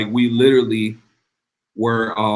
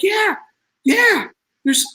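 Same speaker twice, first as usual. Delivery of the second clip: much higher mock squeal of joy; imitating her giddy yes, pitched way up; wife squealing yes about the payment, much higher; excited squeal; slower than usual. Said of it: "Jennifer starts screaming".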